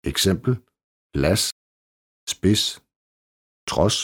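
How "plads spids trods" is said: plads, spids, trods with no D sound before the s; silent D. Shown as a demonstration. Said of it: "Stumt D". (stumt D i plads, spids og trods)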